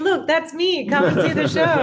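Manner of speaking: high and loud, playful self-promotion; excited pitch. Retold or imitated. Actress to event guests, inviting them to her show excitedly. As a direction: pitch it up like handing out flyers proudly. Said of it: "And I was like".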